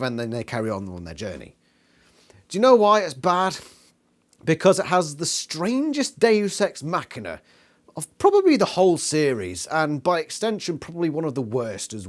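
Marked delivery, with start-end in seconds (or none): none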